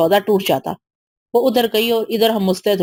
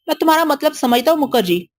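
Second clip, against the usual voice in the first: bitter laugh; 6 semitones higher than usual. A narrator's reading. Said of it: "Vitthal ने जहर हंस से कहा".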